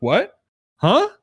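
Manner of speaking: rising what, then high-pitched huh; awkward confusion. Retold or imitated. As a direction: play a puzzled teen, pitch climbing. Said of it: "awkward conversation".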